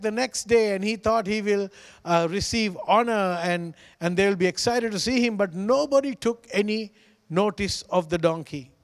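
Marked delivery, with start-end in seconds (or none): none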